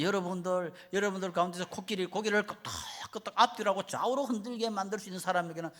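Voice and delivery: high, projected showman's announcement, acted; trainer's challenge. Trainer sets the audience a new challenge, showman's proclamation. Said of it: "또 새로운 제안을 합니다".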